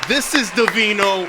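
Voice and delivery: slow, loud DJ voice; radio voice. Shown as a demonstration. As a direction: boom it out like a radio host. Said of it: "That is my fucking radio voice".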